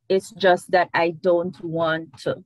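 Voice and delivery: it's just that I don't want to, said very slow; slow English. Shown as a demonstration. Dictating the English line, very slow. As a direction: draw the line out slowly. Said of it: "This is the English version".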